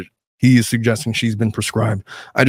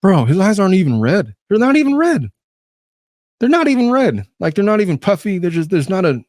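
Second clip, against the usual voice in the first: incredulous; 9 semitones higher than usual. Real, not acted. Commentator mocking fake tears.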